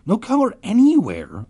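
high, loud anguished cry. A narrator's reading. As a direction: wail it out. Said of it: "a girl cried".

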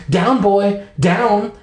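high-pitched dog scolding. A narrator's reading. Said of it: "Miss Gravel scolded".

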